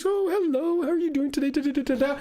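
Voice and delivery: put-on high, sweet old-lady greeting; sweet elderly lady. Narrator imitates the kind Steinway store lady greeting him. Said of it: "this elderly lady, she was so sweet".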